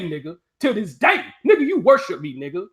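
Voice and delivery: usual speaking voice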